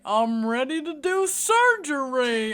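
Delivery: slow, loud mock drunk slur. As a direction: ham up a drunk doctor. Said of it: "That doesn't sound like a drunk voice".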